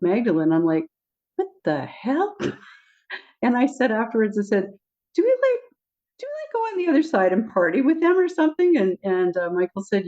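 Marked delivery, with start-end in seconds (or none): 1.4-2.4 higher, slow, drawn-out disbelief; stunned by a strange memory; mock disbelief. Remembering Jesus dancing with Magdalene, slow mock disbelief. 5.1-8.8 very high, quick, giggly question; giddy question. Retold or imitated. Asking Michael if they party on the other side, very high and giddy.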